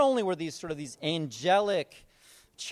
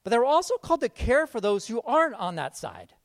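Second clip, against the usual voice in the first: normal talking voice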